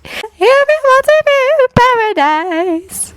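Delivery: put-on high, slow, faraway girl; dreamy voice. A narrator's reading. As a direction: float it out slowly, half elsewhere. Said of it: "in a dreamy voice".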